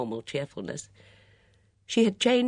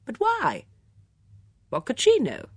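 high, keen questioning; scientific curiosity; 11 semitones higher, 6 dB louder than usual. A narrator's reading. Patrick puzzles over a possible murder, keenly curious. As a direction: puzzle it out eagerly. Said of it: "Patrick asked with scientific curiosity".

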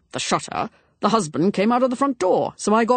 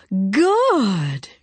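cried out; 4 dB louder than usual. A narrator's reading. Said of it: "cried Lucky".

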